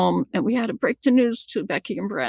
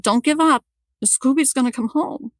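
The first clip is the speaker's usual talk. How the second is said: higher, emphatic rallying of the family; retold encouragement; 6 semitones higher than usual. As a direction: re-enact urging them to keep hope. Reassuring husband and kids.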